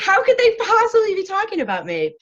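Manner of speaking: loud high indignant cry; mock indignant shout. Mock outrage at feedback.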